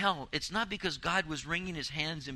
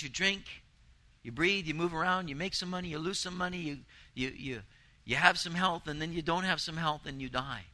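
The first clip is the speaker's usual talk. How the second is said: usual speaking voice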